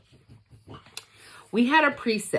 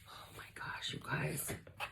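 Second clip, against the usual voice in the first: awed whisper; 4 semitones lower, 21 dB quieter than usual. Real, not acted. Whispered awe at unboxing.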